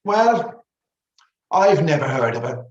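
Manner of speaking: high, loud dismissal; unimpressed cleaning lady. A narrator's reading. Cleaning lady dismissing the leading lady, unimpressed.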